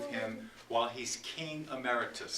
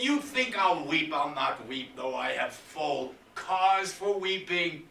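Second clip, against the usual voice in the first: loud, higher threats building then faltering; raging Lear; 5 semitones higher, 9 dB louder than usual. Acted. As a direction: roar the threats, then let grief crack through.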